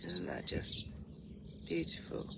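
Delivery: usual speaking voice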